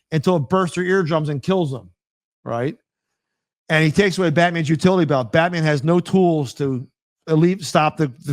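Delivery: ordinary talk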